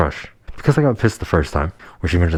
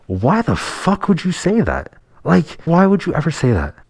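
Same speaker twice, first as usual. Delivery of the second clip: much higher, outraged disbelief; indignant outburst; 11 semitones higher than usual. Real, not acted. Telling viewers about his girlfriend's date line, outraged and high-pitched. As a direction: hit it high, stunned and furious at her.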